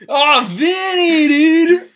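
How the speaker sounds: slow, high, very loud hail; imitating a boozy greeting; drunken shout. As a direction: bellow the name like a delighted drunk. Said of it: "And then Eric walks in, he goes".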